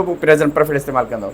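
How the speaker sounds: ordinary talk, nothing marked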